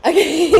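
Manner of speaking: high, loud flustered giggle; nervous laugh. Real, not acted. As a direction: laugh off the mistake. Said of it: "Sorry, I'm a little nervous".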